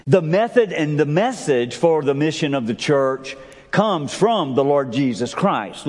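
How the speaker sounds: normal talking voice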